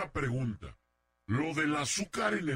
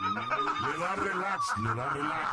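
high, slow words broken by laughter; laughing mock; 3 semitones higher, slower than usual. Real, not acted. The host teasing the guest about her revenge, laughing through it.

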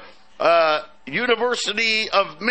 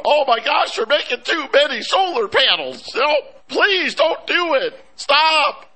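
high, hammed-up begging; mock pleading; 8 semitones higher than usual. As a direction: plead in mock panic, pitch way up. Mocks a supposed plea to China.

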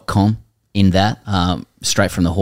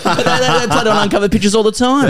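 high mock voice; 14 semitones higher than usual. Mocking old-timer view.

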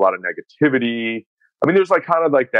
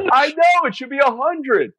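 very high-pitched, worked-up mock exclamation; excited retold agreement; slower than usual. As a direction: imitate a coach exclaiming in emphatic agreement. Retold coach's heated agreement.

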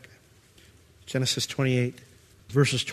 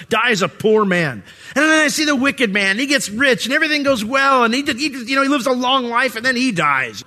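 Asaph's complaint; 9 semitones higher than usual. Retold or imitated. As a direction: voice the doubter's grievance, pitched up and pressed. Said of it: "Asaph said the same thing".

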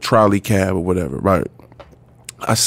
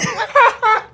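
high burst of laughter. Real, not acted. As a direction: let out a big high laugh. Laughing at own story.

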